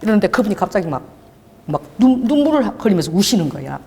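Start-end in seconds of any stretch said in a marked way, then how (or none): none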